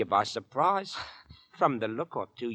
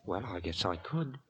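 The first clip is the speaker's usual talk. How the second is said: ordinary delivery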